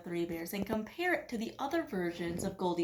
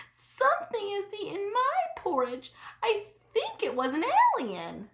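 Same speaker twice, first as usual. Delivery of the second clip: little bear voice; 10 semitones higher than usual. A narrator's reading. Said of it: "looked sadly at his bowl".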